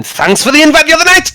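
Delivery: high-pitched jeering shout; mock taunting call. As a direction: call it out high as you swing. Voicing attacker's taunt mid-swing.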